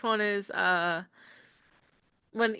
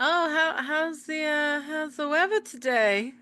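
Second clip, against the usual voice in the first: forced small talk; 7 semitones higher, 4 dB louder, slower than usual. Pretend. Awkward elevator small talk.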